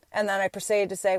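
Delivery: normal talking voice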